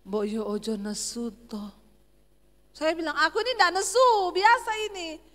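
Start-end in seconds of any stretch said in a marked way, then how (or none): 0.0-1.6 low, mild Javanese plea; soft-spoken Solo husband. Retold or imitated. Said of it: "Orang Solo selalu rendah". 3.1-5.2 loud, high-pitched denial; high Sulawesi tone. Retold or imitated. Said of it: "orang Sulawesi kalau ngomong selalu nadanya tinggi".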